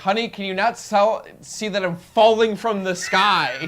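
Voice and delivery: high, loud mock-indignant comeback; hammed-up exasperated pilot; exasperated retort. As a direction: protest loudly, stretching the end. Acts exasperated pilot answering.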